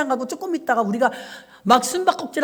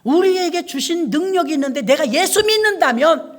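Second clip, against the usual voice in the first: louder, fervent sermon climax; preaching at full force; 4 semitones higher, 4 dB louder than usual. Acted. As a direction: drive it home to the congregation. (회중에게 힘차게 외치는 설교)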